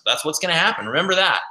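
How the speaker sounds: ordinary delivery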